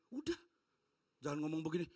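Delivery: normal talking voice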